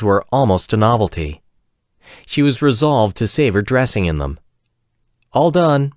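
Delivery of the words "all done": called out, higher and a little louder; calling out. A narrator's reading. Martine calling to the men that she's dressed. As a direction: call through to the men to turn back. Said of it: "Martine finally called".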